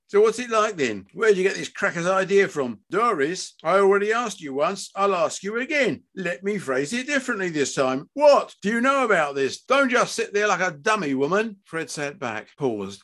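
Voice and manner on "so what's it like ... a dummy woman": high, heated demands; angry father losing patience; exasperated interrogation. Acted. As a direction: grill your wife angrily. Father berates son and wife.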